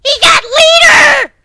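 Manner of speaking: very high, slow squeaky shout; Halo grunt. Acted. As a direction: shriek it like a panicky alien grunt. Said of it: "one shouted, reminding me of a grunt from Halo".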